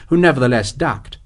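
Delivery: usual speaking voice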